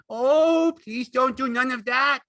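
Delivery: loud, high wail of a plea; frightened begging. A narrator's reading. Lenny begs her to stop screaming, frightened. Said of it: "began to cry with fright".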